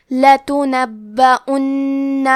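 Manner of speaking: لتنبؤن recited slowly, each vowel clear and the final نون doubled; slow, careful لتنبؤن with every haraka sounded; tajweed recitation. Shown as a demonstration. Teacher models لتنبؤن with all three vowels clear. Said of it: "تینوں حرکات کو ظاہر کریں گے".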